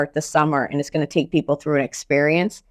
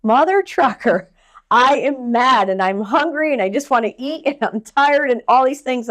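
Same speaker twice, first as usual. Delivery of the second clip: mock frustrated rant; 6 semitones higher, 3 dB louder than usual. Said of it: "I was like".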